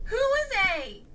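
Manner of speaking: angry, fast, loud and high demand. A narrator's reading. Maya confronting Klaus about the card, angry. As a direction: fire the question at him hard. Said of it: "angrily said Maya".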